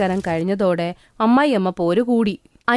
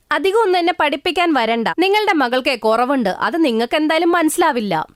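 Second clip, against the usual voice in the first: accusing mother-in-law; 3 semitones higher than usual. Acted. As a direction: spit out the blame like a bitter mother-in-law.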